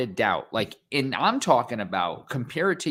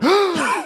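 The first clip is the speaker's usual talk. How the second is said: sharp high gasp of shock; loud high gasp; 8 dB louder than usual. Retold or imitated. Fan imitating crowd's shocked gasp. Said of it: "You can hear them go".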